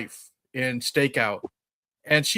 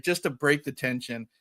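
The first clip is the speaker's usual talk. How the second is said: normal talking voice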